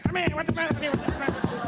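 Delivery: put-on high, fast-talking old-film leading man; 1930s movie guy. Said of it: "old nineteen thirty guy's voice".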